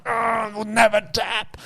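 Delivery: high, slow defiant boast in a put-on voice; mock-defiant training partner; stubborn partner. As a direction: play the proud guy who won't give in.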